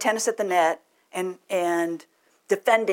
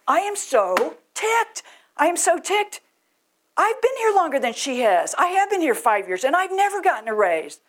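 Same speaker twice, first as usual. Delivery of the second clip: irate worker; 9 semitones higher, 3 dB louder than usual. Retold or imitated. Irate worker yelling at the manager about a raise, hammed-up high shout. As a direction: storm in as a worker who got passed over. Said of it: "But the person would persist".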